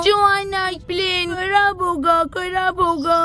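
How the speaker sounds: put-on a little boy crying that his plane is ruined; sobbing toddler.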